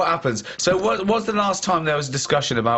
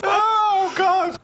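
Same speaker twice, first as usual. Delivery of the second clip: laughing; much slower than usual. Real, not acted. Laughing at the story.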